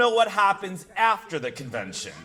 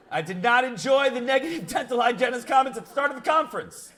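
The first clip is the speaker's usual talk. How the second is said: fast, raised-pitch recital of a complaint; mock complaint reading; 4 semitones higher, much faster than usual. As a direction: race through the grievance in mock indignation. Reads complaint reviews aloud comically.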